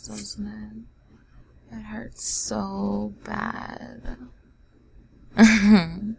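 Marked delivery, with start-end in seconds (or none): 5.4-6.1 loud taunting laugh; mocking laugh. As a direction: laugh then start talking. Laughs at listener.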